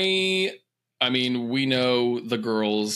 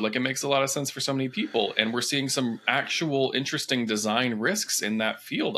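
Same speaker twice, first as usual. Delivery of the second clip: usual speaking voice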